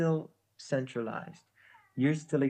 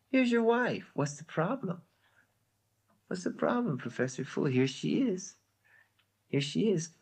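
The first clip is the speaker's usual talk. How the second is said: high, teasing incredulity; mock incredulous; 6 semitones higher than usual. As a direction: needle him with fake puzzlement. Mocking the professor over the body.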